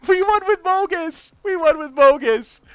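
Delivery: giddy, still laughing at the win; excited laughing. Real, not acted. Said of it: "I'm too excited".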